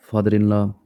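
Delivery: ordinary talk, nothing marked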